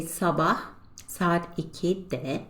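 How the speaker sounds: slow, careful Turkish for learners; slow model pronunciation. Shown as a demonstration. Teacher modelling Turkish phrase to students, slow and careful. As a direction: spell it out slowly for the class. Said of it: "we say".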